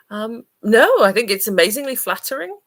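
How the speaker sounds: enthusiastic. Real, not acted. Emphatic answer to question.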